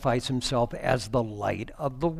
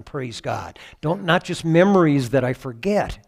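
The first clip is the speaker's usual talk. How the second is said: ordinary talk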